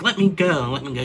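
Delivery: high, loud cry to be let go; wife yelling at her husband to let her go; retold yell. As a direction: pull away shouting. Wife struggling in his grip.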